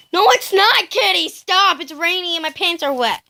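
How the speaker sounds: high, loud whiny cat complaint; pet voice. Pretend. Voicing upset cat.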